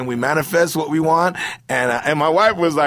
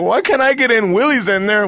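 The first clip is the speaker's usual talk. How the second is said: higher-pitched mock indignant complaint; put-out, high-pitched whine of protest; 7 semitones higher than usual. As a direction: act out being wronged at the gate. Acting out complainer at heaven's gate.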